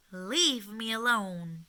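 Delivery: loud, slow exasperated yell; shouted. Acted. Old woman yelling at noisy mountain goats, loud and slow. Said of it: "shouted the old woman".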